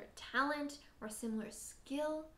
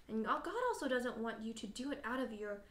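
usual speaking voice

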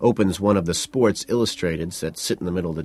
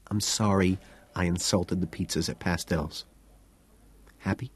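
whisper; 7 dB quieter than usual. A narrator's reading. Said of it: "I whisper".

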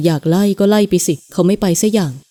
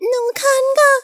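put-on small, much higher child's voice; little girl's voice. Little girl telling the man she feels itchy, in a small high child's voice. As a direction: sound like a tiny girl. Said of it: "เสียงเล็กๆก็ตอบกลับ".

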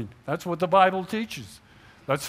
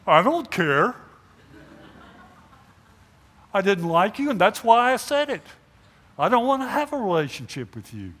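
louder, curt brush-off, getting faster; stubborn mock rejection, raised voice; defiant refusal; 4 semitones higher, 5 dB louder, faster than usual. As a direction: throw the apology back in their face. Acted refusal after apology.

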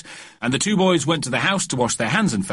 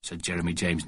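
plain reading voice

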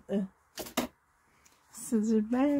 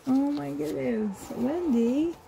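ordinary delivery

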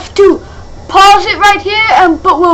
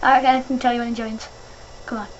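whispering, softer, urging silence; hushed; 6 semitones lower, 11 dB quieter than usual. Real, not acted. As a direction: hush everyone before the guest joins. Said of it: "keep quiet, keep quiet".

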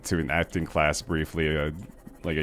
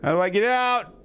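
high-pitched distressed wail; trapped and yelling for help; panicked pleading; 14 semitones higher, slower than usual. Real, not acted. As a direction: cry out for rescue, high and desperate. Trapped and attacked in game.

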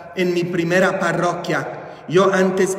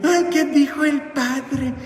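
higher, prissy pious gasp; mocking prude; 7 semitones higher than usual. Priest mocks scandalized pious churchgoers in a higher, prissy voice. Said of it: "santurrones persinados que dicen".

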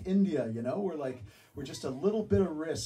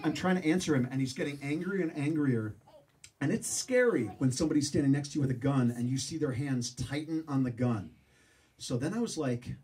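normal talking voice

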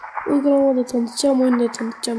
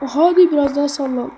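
normal talking voice

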